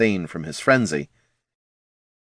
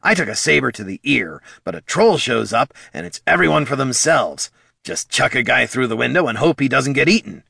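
complain, put-upon; kobold grumble; 5 semitones higher than usual. A narrator's reading. Bert griping about being thrown through a window.

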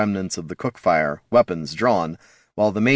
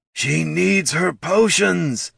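high, very slow troll voice; worried troll; 10 semitones higher, much slower than usual. A narrator's reading. Troll worried for the missing ranger, slow anxious plea. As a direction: stretch out each word anxiously.

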